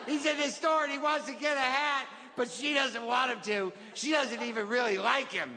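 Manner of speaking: put-on high, singsong little-kid voice; child retelling a storybook. Kid sharing the favorite book mom got, childlike storytelling.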